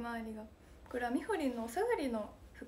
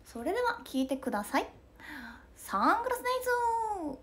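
much higher stage-announcer call; 8 semitones higher than usual. Retold or imitated. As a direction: announce the song, then call out to the crowd. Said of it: "ツアーの[name]さん".

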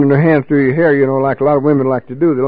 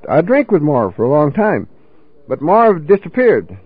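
ordinary talk, nothing marked

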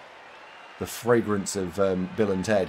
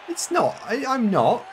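mock protest; 4 dB louder, slower than usual. Denying bullying accusation.

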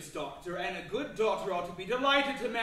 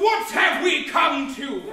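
loud, slow, higher outraged cry; indignant shout; 3 semitones higher, 10 dB louder, slower than usual. Acted. Master outraged at his servant's boldness, angry shout. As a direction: bellow at the insolence.

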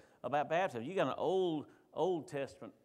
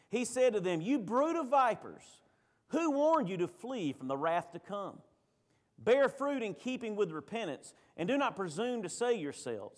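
raised, declaimed Bible reading; scripture reading; 4 semitones higher than usual. Acted. Preacher reads John the Baptist's rebuke to the congregation, declaimed scripture reading. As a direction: proclaim the verses with preacher's force, pitch lifted.

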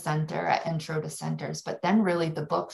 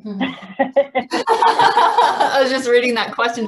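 talking through a real laugh; amused, laughing her words out slowly; 7 semitones higher, 9 dB louder, much slower than usual. Real, not acted. Presenter to colleagues, laughing as she reads the question.

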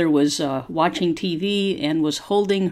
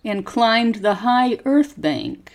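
slow, careful story reading for students to repeat; slow model reading; 3 semitones higher than usual. Shown as a demonstration. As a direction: pause after each phrase and lift the tune clearly. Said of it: "Copy how my voice goes up and down".

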